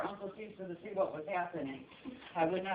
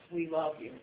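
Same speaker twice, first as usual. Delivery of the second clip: normal talking voice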